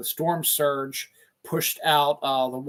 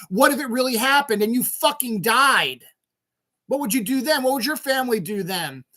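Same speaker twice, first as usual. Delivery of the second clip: higher-pitched indignant outburst; angry rant; 9 semitones higher than usual. Real, not acted. Scolding fans who want refunds, heated high rant. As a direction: hurl the questions at them.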